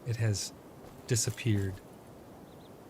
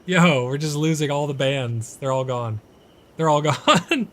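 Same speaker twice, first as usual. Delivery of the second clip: louder, higher, half-laughing disbelief; exasperated outcry; 4 semitones higher, 7 dB louder than usual. Real, not acted. Streamer watching all the bands vanish, exasperated outcry. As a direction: let the frustration burst out with a laugh.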